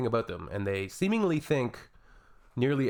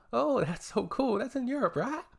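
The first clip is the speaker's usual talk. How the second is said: ditzy mock questioner; 14 semitones higher than usual. Mocking the person who asked about Argentina in a high airhead voice. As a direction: play the clueless asker, sing-song and high. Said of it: "and they go".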